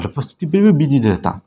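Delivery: ordinary talk, nothing marked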